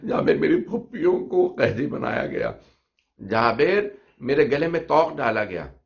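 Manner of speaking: loud, high sobbing recitation to Jabir; wailing lament. Acted. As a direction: cry the grief out, voice breaking upward. Imam's lament told to Jabir. (Jabir से रोते हुए ऊँची आवाज़ में विलाप)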